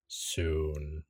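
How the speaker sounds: low, drawn-out ominous word; quiet, deep and foreboding; deep rumbling voice. Acted. Mysterious voice tells Iris when, deep and rumbling. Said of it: "As the voice says".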